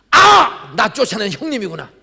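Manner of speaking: loud, high shout of recognition; sudden realization. Retold or imitated. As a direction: exclaim it as it dawns on you. Realizing the caller is his brother. (형님인 걸 깨닫고 크게 외치듯)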